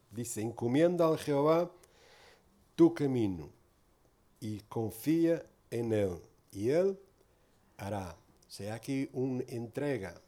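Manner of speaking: normal talking voice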